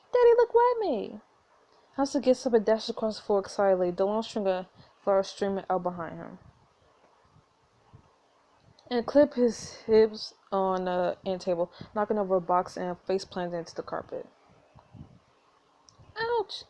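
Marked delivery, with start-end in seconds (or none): 0.1-1.2 put-on high-pitched excited little kid; small child, high and eager. Excited child showing dad something. 16.2-16.6 put-on hurt child. Child hurts elbow after falling.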